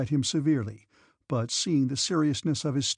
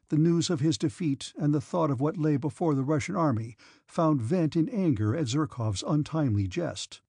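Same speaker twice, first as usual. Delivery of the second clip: ordinary delivery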